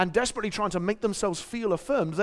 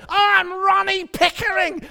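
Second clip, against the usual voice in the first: put-on higher, slow, proud northern accent; hammed-up northern accent; Ronnie Pickering northern accent; 13 semitones higher, 7 dB louder, slower than usual. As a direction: announce his name like a northern celebrity. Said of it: "only got one northern accent in my toolkit".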